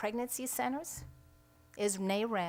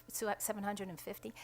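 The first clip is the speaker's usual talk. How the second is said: normal talking voice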